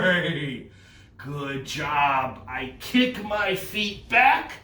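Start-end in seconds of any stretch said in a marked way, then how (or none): 0.0-0.7 long, fluttering whinny; whinny like a horse for kids; drawn-out imitation neigh. Shown as a demonstration. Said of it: "like a horse and neigh".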